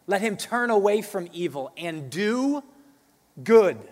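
preaching scripture. Acted. Scripture read loudly.

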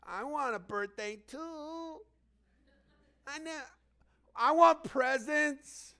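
a hint of a whiny, jealous sister; lightly voiced envious little sister; jealous child. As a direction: sulk like a left-out kid.

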